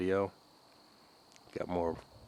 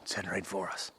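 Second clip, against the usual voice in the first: hushed whisper spotting a bird; breathy quiet whisper; 6 dB quieter than usual. Real, not acted.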